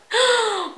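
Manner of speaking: high gasp through a laugh. Real, not acted. Laughing at how they thought life would change, high gasp. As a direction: suck in air mid-laugh. Gasps amid her own laugh.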